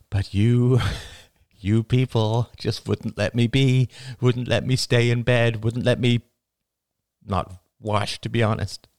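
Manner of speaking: a mother thanking her community; earnest mother. A narrator's reading. Karen thanks friends.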